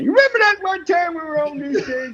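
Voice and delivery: put-on high, loud old man voice. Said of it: "very old man".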